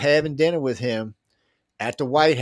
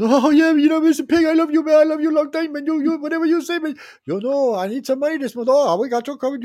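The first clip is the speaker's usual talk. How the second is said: very high, loud mocking Biden voice with an accent; 5 dB louder than usual. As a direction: ham up a fawning Biden in a squeaky accent. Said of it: "I didn't mean to use an accent".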